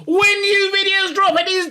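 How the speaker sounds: high-pitched, loud YouTube host greeting; big, high announcer-style sign-on; hyped channel intro. Acted. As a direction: call out to subscribers at the top of your range.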